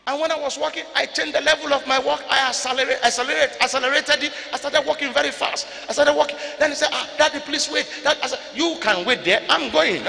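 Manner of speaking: loud, fast-building storyteller's excitement; excited loud retelling. Real, not acted. Preacher telling the church about striding off, excited and loud. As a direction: push it up, eager and loud.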